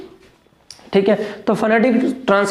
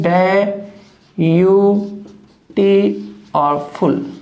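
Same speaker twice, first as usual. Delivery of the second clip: slow syllable split; much slower than usual. Shown as a demonstration. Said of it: "beautiful की अपन बात करें".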